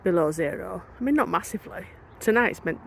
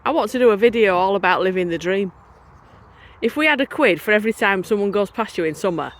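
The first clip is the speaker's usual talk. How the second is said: ordinary delivery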